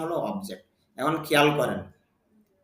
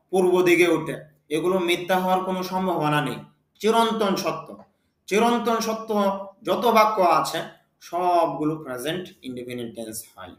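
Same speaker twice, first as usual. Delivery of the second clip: ordinary delivery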